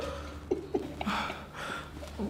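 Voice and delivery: breathy amused laugh; soft laugh. Acted. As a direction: laugh quietly to herself. Mother laughs.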